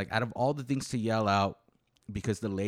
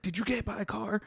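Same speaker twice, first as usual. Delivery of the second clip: frantic aunt; 11 semitones higher than usual. Retold or imitated.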